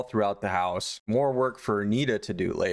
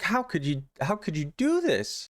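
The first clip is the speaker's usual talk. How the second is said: higher, mock-horrified wife; aghast, pleading imitation; terrified, mortified; 7 semitones higher than usual. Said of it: "she's terrified".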